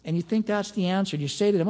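ordinary talk

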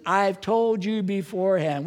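slow, weighty delivery of told; solemn quote. Shown as a demonstration. Pastor quoting Jesus to the church, slow and weighty. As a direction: lean on "told" and draw it out. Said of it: "he said".

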